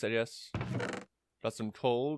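ordinary delivery

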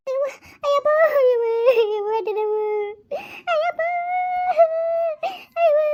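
high sobbing wail of pain; crying in pain. Acted. Woman in labor, sobbing while her mother calms her. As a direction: cry out as the pains hit. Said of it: "ಅಳ್ಬೇಡ".

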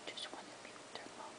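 whisper. Real, not acted. Whisper to dog.

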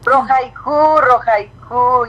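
Rojaijú said high and loud, then drawn out slowly syllable by syllable; saying Rojaijú. Shown as a demonstration. For a Paraguayan fan, careful high slow Rojaijú. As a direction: sound out "Rojaijú" carefully for the fan, ro-hai-HOO. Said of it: "pide que le digas Rojaijú".

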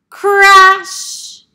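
loud high shouted crash; big cartoon crash. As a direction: belt out the crash of the buckets. Duck falls into buckets.

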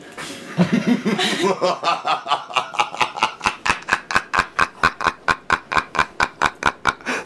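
long drawn-out villain cackle; evil cackle. Pretend. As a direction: cackle slowly like a cartoon villain. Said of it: "You want me to do my evil cackle?".

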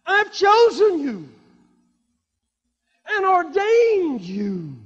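loud, high, drawn-out scripture proclaiming; preaching at full force. Acted. Preacher proclaiming Jesus' words to the congregation, at full force. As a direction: preach the verses out to the church.

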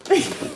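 brief high-pitched giggle. Real, not acted. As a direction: let out a quick delighted squeal of laughter. Delighted by her favourite find.

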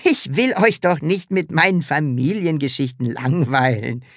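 plain reading voice

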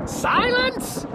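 very high-pitched kingly bark; barked command. A narrator's reading. Turtle king silencing his complaining subject, sharp bark. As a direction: cut them off with an imperious cry. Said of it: "the king of the turtles barked back".

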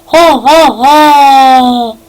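deliberate mock-villain laugh with a long last "ha"; stagey villain's laugh; evil laugh. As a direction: fake evil cackle, each "ha" separate. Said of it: "Şeytani gülüşler".

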